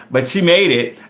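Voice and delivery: ordinary talk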